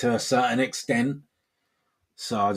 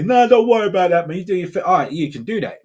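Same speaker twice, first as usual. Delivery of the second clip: mocking reassurance; 8 semitones higher, 7 dB louder, faster than usual. Mocking people's easy advice.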